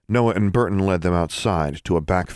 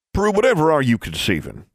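calling back; 10 semitones higher, 3 dB louder than usual. Acted. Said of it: "Noah called back".

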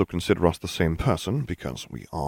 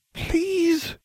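very high, testy repeat. A narrator's reading. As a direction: press the plea impatiently, pitched right up. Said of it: "she repeated testily".

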